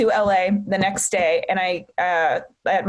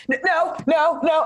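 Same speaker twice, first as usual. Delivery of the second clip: higher, mildly tearful refusal; retold tearful refusal; 7 semitones higher than usual. Daughter recalling her answer to mom, mock tearful refusal. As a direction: act out a stuttered, pleading no. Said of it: "I just started crying".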